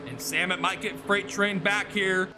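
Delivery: normal talking voice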